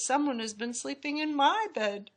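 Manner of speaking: high mama bear voice, ordinary volume; middle-sized Mama Bear voice. A narrator's reading. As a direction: pitch it up as an indignant mama bear. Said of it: "said Mama in her middle-sized voice".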